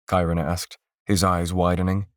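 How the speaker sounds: ordinary delivery, nothing marked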